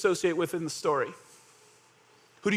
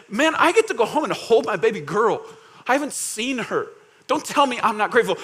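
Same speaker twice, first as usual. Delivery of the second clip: raised, indignant protest; emotional pushback; 3 semitones higher, 4 dB louder than usual. Acted. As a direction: push back with feeling, pitched up. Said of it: "Emotionally, I think they would push back".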